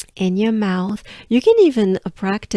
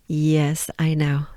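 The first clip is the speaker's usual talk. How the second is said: usual speaking voice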